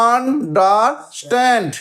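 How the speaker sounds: Understand split slowly into three separate beats; syllable by syllable. Shown as a demonstration. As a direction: break understand into un, der, stand with gaps. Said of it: "কটা syllable?". (Understand ধীরে ধীরে, তিন সিলেবলে ভেঙে)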